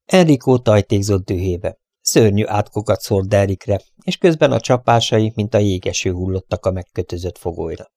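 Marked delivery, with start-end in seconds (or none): none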